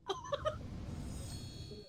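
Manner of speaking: soft, very high wheeze; real breathless laugh; high wheezing laugh. Real, not acted. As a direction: laugh until only air comes out. Laughing after calling the count.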